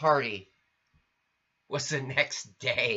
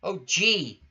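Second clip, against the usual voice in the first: peep; 5 semitones higher, 3 dB louder than usual. Acted.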